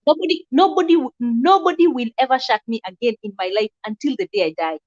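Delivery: ordinary talk, nothing marked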